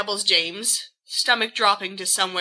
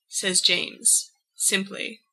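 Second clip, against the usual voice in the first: ordinary delivery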